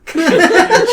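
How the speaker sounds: high giggling burst; burst of laughter. Real, not acted. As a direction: break into a giggle. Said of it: "laughs mid narration".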